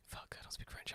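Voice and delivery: voiceless whisper of panic; hushed, caught-out whisper; panicked whisper. Retold or imitated. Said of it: "And I'm like".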